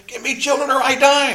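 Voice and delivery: high, loud dramatic demand; desperate Rachel. Retold or imitated. Rachel demands children from her husband, loud and desperate. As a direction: act out a desperate plea, pitched right up. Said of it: "Remember what, what Rachel did?".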